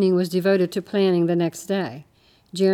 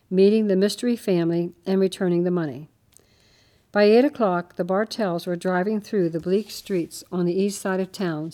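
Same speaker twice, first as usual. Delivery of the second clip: ordinary delivery, nothing marked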